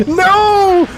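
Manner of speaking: high, held cry of protest; dismayed cry. Real, not acted. Gamer swarmed by enemies, high dismayed cry.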